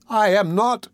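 put-on high-pitched boy's denial; alarmed boy. A narrator's reading. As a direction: deny it high, with mild alarm. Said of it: "greatly alarmed".

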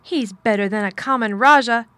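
loud, emphatic comeback; indignant counterattack; heated retort. A narrator's reading. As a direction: retort forcefully, punching the words. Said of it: "retorted Mary".

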